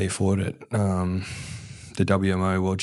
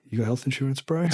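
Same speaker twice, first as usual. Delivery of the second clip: a higher, teasing taunt; taunting quote; 6 semitones higher than usual. Retold or imitated. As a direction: throw his question back at him. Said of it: "I was like".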